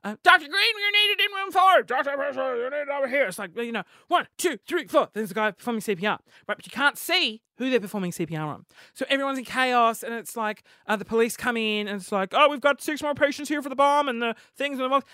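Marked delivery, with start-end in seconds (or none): 0.2-3.3 high, loud urgent paging; acted hospital staff. Podcaster playing chaotic hospital staff calling doctors, urgent call. 4.1-5.1 urgent, raised counting; acted CPR count. Medic giving CPR in a made-up scene, urgent count. 12.3-15.0 acted emergency report. Acted police arriving amid chaos.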